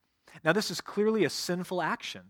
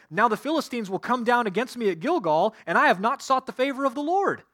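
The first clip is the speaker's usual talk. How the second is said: raised high and loud, pleading as panicked King Saul; Saul's desperate plea; 8 semitones higher, 7 dB louder than usual. Acted. Preacher voicing King Saul explaining his fear to Samuel, loud and desperate. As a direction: cry it out like a frightened king justifying himself.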